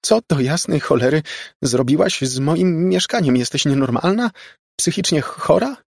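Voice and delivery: high, heated accusing questions; angry outburst. A narrator's reading. Margo berates the girl who wrecked her flat, angry and high.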